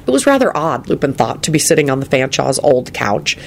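ordinary delivery

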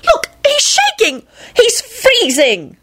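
very high, drawn-out wailing; acted tearful-sounding distress. A narrator's reading. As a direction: squeal in pitying alarm. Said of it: "Rosie wailed".

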